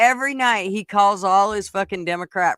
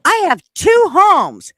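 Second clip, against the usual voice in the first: hammed-up furious resident voice; mock angry lady; 9 semitones higher, 7 dB louder than usual. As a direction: yell it like the fed-up homeowner. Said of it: "then she says".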